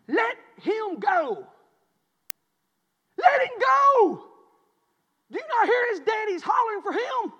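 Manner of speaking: slow, high, loud order; stretched-out acted shouting; neighbor's yell. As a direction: holler it at a boy far downhill. Neighbor yelling at his boy.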